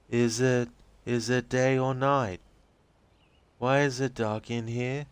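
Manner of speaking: put-on high, slow, dreamy young woman's voice; spacey and childlike, drifting; dazed Rose. Rose answering the agent's questions, dazed and high-voiced.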